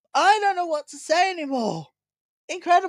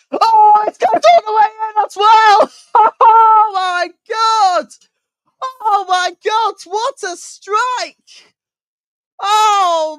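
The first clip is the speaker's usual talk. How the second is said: loud, high laughing goal shout; long goal yell; 9 semitones higher, 10 dB louder, slower than usual. Real, not acted. Goal scored, commentator yells.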